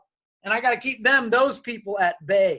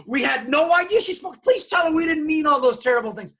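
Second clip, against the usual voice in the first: panicked parents; 6 semitones higher, 4 dB louder than usual. Retold or imitated. Parents panic after the surprise.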